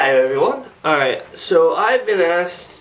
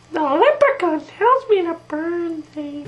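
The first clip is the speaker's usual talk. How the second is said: very high, simple-minded cartoon voice; high-pitched oddball cartoon impression; cartoon character; 10 semitones higher than usual. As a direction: pitch it way up and say it innocently. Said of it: "do an impression video of all my".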